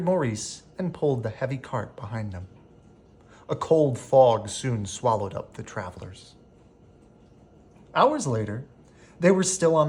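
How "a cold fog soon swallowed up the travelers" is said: ordinary delivery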